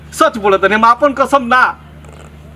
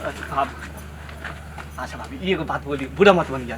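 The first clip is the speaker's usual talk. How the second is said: low, hushed appeal; meek coaxing; 7 semitones lower, 9 dB quieter than usual. Acted. Villager placates sister-in-law after her scream, hushed and meek. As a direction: back down and speak very softly.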